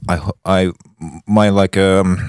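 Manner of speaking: usual speaking voice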